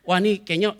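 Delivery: ordinary talk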